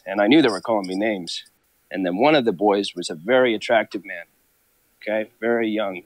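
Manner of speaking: normal talking voice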